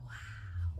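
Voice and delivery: breathless whispered wow; awed whisper. Retold or imitated. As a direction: hush it out, amazed and soft. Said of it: "My boss was like".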